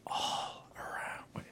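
very slow whisper. Shown as a demonstration. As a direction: breathe the words slowly. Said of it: "I can't tell if this is working".

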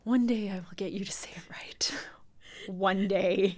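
exasperated whisper. Real, not acted. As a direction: breathe it out under your breath. Exasperated aside to cohost.

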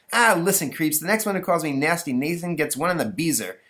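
high, bratty schoolboy threat; threatening bully Nathan. Acted. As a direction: warn them like a playground bully.

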